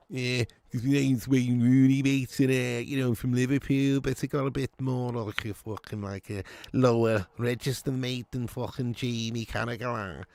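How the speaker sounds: a rough go at a Liverpool footballer's voice; Wayne Rooney. Acted. Streamer trying a requested Liverpool player voice, rough impression. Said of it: "Rooney voice".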